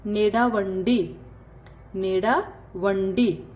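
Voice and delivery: नेडावंडी said slowly twice; slow and deliberate; slow drill. Shown as a demonstration. Teacher drills Telugu for walk slowly. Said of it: "चलने को क्या कहते हैं".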